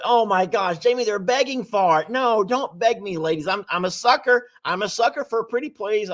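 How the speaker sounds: ordinary talk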